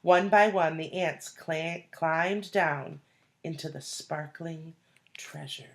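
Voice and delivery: lively read-aloud storytelling voice; story narration. A narrator's reading. Teacher reading the ant story to children, storytelling voice. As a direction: read it like a picture book to the class.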